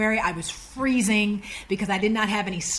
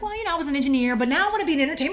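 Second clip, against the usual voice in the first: higher-voiced rehearsed pitch; eager self-pitch; 7 semitones higher, much faster than usual. Applicant telling the executive about herself, eager. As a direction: launch into it in a raised voice.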